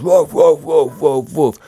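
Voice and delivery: mock barking in a yappy put-on bark; yapping woofs, done as a bit; dog barking imitation. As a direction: imitate the annoying dog next door. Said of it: "And this fucking dog goes".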